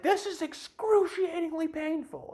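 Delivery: high, slow, strained complaint; mock pained whine. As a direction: whine it like the pain is unbearable. Quoting himself in pain.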